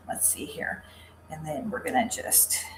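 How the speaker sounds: muttered in a whisper. Real, not acted. Crafter struggling with a knot, whispering to herself.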